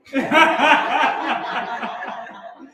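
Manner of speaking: a long real laugh. Real, not acted. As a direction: laugh freely. Laughing after the song.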